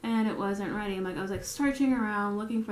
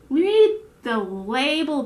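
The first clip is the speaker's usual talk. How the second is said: loud, slow, higher retort; mock exasperation; 4 semitones higher, 8 dB louder, slower than usual. Baker to customers, loudly exasperated. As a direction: spell it out for a clueless customer.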